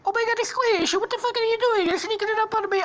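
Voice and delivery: very high, loud cartoon panic; panicked character voice. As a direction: squeal in mock alarm. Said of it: "then he's like".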